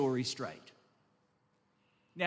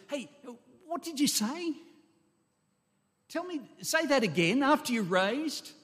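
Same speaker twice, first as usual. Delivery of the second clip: high-pitched, startled call for a repeat; interrupt, urgently wanting it repeated; astonished questioning; 8 semitones higher than usual. Acted. Imagined listener asking to hear again.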